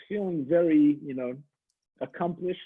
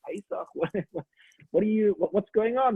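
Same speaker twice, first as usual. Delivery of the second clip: flustered surprise; 4 semitones higher than usual. Acted. Chaim confused, laughing.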